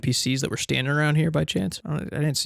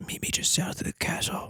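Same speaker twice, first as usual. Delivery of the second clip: hushed secret whisper; 5 semitones higher, 9 dB quieter than usual. Acted. Lydia secretly arranging a meeting, whispering. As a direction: breathe it without voice.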